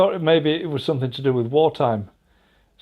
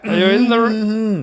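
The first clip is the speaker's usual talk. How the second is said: posh host voice; 6 semitones higher, slower than usual. Pretend. Slow posh repeat.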